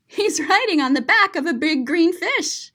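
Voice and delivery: ordinary delivery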